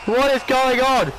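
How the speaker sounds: high, drawn-out exclamation; bewildered cry. Real, not acted. Player lost in the match, bewildered cry. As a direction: cry out in baffled alarm.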